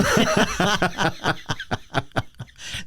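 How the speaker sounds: high bursting laugh at the memory; cracking up while telling the story; real laugh. Real, not acted. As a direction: let a high, helpless laugh break out.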